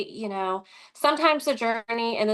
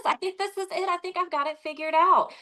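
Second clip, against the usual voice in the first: high, bright-eyed rush of discovery; retold excitement to husband on the phone; excited; 8 semitones higher than usual. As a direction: relive the thrill of finally finding it. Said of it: "I was so excited".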